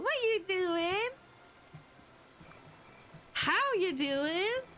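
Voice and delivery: high, slow sing-song pet voice. Real, not acted. Greeting her dog in baby talk.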